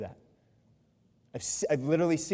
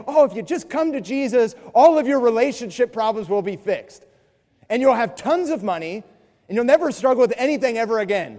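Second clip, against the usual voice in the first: mock prosperity promise; 6 semitones higher, 9 dB louder than usual. Mimicking people's claims.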